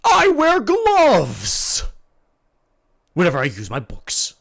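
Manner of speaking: big earnest himbo, loud and proud; dopey sweet himbo. Acted. GM plays the booming himbo Bang.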